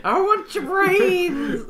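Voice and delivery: high, slow groaning zombie. Pretend. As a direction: moan it like a hungry zombie. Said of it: "What would his zombie hick voice be?".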